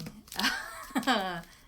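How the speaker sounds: a real, short amused laugh. Real, not acted. Laughing at her own book pick being problematic, short real laugh. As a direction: laugh it off lightly.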